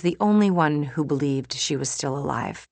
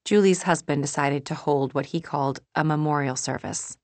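plain reading voice

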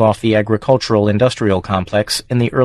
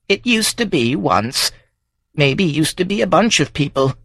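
put-on old Wendy, musing; 7 semitones higher than usual. Old Wendy muses on farms.

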